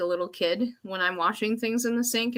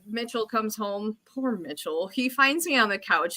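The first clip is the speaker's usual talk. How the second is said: normal talking voice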